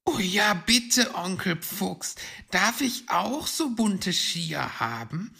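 high, loud, eager little-one voice; high-pitched, excited plea to an uncle; Weißohr pleading. A narrator's reading. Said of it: "bat nun auch Weißohr".

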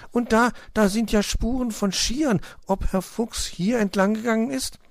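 put-on very high, eager child voice; increasingly excited. A narrator's reading. Little hedgehog finding ski tracks, increasingly excited. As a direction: wonder aloud with mounting excitement. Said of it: "wurde der kleine Igel immer aufgeregter".

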